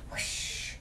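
voiceless rushing whoosh; imitated rush of air; flying whoosh. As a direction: whoosh like taking off. Flying noise in story.

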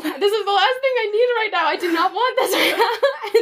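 high, laughing mock despair; laughing exasperation. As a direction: squeal it out, laughing at your own bad luck. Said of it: "and I thought".

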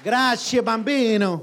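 big booming Italian thanks; playful loud thanks. Pretend. Speaker thanking his interpreter in Italian, jokingly loud. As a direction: belt it out for laughs.

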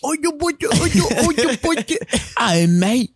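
put-on very high, squawky caricature of Australian accent; mock Australian accent. Podcaster goofing for his laughing friend, hammed-up high Australian accent. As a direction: push a silly high-pitched Australian accent. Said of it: "I could not stand Australian accents".

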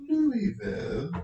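hammed-up accent on "Louisville", as Looey-vul; crappy put-on accent. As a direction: fake a bad accent on "Louisville". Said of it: "crappy accent".

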